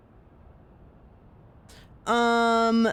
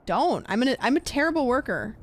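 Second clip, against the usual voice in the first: usual speaking voice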